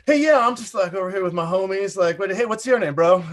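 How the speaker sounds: hammed-up casual celebrity. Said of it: "And he's like".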